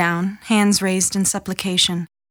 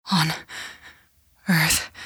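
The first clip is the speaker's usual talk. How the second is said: halting prayer; 3 semitones lower, 4 dB quieter than usual. A narrator's reading. Dying mother's halting prayer.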